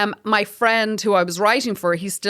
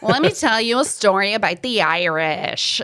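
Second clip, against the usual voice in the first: put-on American woman's voice; exaggerated North American accent. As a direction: play an American woman, accent laid on thick. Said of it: "try to do a North American accent".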